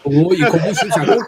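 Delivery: mock-offended Welsh accent. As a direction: echo the quarrel in a playful Welsh voice. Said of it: "a Welsh guy having an argument".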